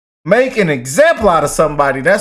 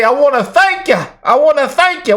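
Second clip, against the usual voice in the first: higher-pitched mimicry of a drunk lady; 6 semitones higher than usual. Vlogger mimicking a lady's viral thanks, drunk lady impression. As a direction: imitate a tipsy woman's gushing thanks. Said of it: "that lady that was drunk as hell".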